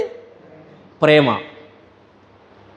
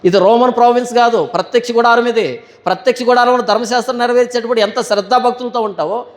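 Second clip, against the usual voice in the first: ordinary talk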